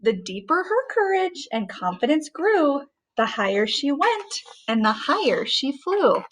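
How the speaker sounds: put-on measured read-aloud voice for a child; a parent reading a rhyming story aloud; storybook narration. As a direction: read it like a bedtime picture book. Mother reads picture book aloud.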